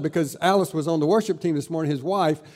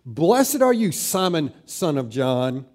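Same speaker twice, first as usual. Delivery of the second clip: "Simon" and "son of John" set apart for emphasis; emphatic full name; slower than usual. Acted. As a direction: hit "Blessed are you" hard, then spell out "Simon, son of John". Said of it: "is adding emphasis".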